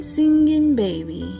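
ordinary delivery, nothing marked

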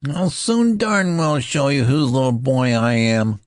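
put-on high, loud furious boy's threat; small boy furious at the colonel; ferocious voice. A narrator's reading. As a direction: spit it out ferociously in a treble voice. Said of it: "in a ferocious voice".

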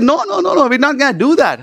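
high-pitched, quoted string of protests; emphatic refusal. Retold or imitated. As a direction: reject the idea outright, pitch leaping up.

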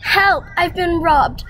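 loud, high alarmed cry; panicked shout from upstairs; shouting for help. Acted. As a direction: yell out that you've been robbed. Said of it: "we heard Granddad shouting".

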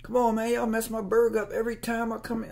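high-pitched mock customer complaint; imitating an indignant customer; irate customer. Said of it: "people come in there just fight people".

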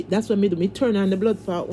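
usual speaking voice

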